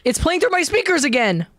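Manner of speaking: usual speaking voice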